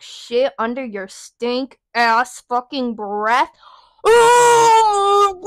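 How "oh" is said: a loud, much higher outraged "Oh"; furious shout. Real, not acted. Reliving the coworker's muttering with a furious shout. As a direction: blow up at the memory.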